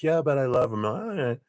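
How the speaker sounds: high-pitched lovesick protest; protesting heart. Retold or imitated. Voicing the heart arguing with the head, mock pleading. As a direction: plead lightly, pitch jumping up. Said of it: "the heart was still like".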